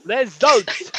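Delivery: high, slow announcer title read; mock results announcer. Host announcing his made-up results in a high mock-announcer voice. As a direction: intone it like a TV results jingle. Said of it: "Premier League results".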